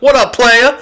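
high, loud, hammed-up street greeting; Zach. Friend mimicking coworker greeting a customer, exaggerated and loud. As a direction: shoot the pitch way up, mock-hearty impression. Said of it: "Zach talking to a customer".

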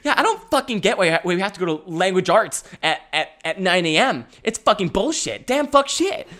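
mock kid rant. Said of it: "We're like".

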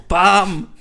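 loud, high "bam"; sudden booming hit sound; shouted impact noise. Retold or imitated. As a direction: punch it out like a cartoon blow. Imitates being boxed out.

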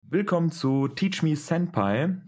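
high, slow, bright show greeting; excited anime fan. Acted. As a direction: welcome them like an eager host. Host greets viewers, show opener.

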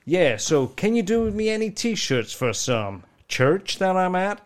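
slow American drawl; lightly done cowboy voice, asking a favour; Texan request. Retold or imitated. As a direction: ask slowly like the cowboy. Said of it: "And then he said".

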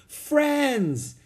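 high, loud joyful shout; happy exclaim. Acted. Jack making up with Boo Boo, loud joyful exclaim. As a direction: burst out gladly. Jack answers gorilla happily.

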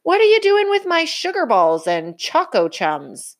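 mild outraged shout; indignant shout. Acted. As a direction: protest for his precious treats. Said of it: "shouted Papa".

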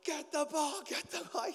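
high, loud sideline yell; cheering parents. Retold or imitated. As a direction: urge the kids on from the sideline. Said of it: "all the parents are like".

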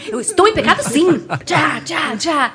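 high, laughing playful shout; hamming up stubborn faith; laughing mock defiance. Brincando, exagerando a própria teimosia. (teimosia rindo e gritando de brincadeira)

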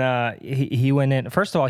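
ordinary talk, nothing marked

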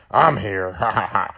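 low, mocking villain voice with an evil chuckle; sinister Freddy. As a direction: gloat like a monster from the dark. Voicing Freddy in the dream.

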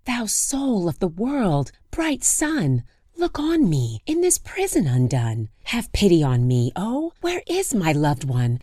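high pleading lament; plaintive lament. Acted. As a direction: plead to the sun, slowly. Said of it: "she said".

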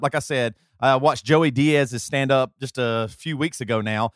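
ordinary talk

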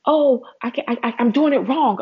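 hammed-up helpless complainer; whiny complainer.

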